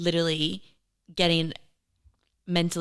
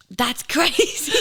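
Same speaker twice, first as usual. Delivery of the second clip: laughing; 6 semitones higher, 4 dB louder, much slower than usual. Real, not acted. Both laughing hard.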